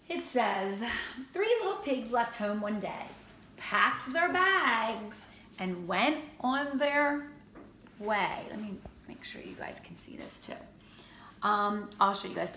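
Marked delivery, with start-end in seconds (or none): 1.3-8.5 storybook read-aloud; gentle read-aloud voice; reading to children. A narrator's reading. Reading picture book to kids. 8.5-10.5 whispered aside; quick whispered aside to the class. Real, not acted. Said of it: "aside whisper".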